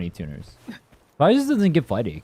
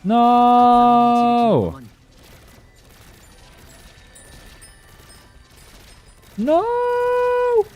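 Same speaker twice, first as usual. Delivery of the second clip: high, loud cry of frustration; frustrated yell; 10 semitones higher, 4 dB louder than usual. Real, not acted. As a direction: yell no at the screen. Missing shots in a shooter.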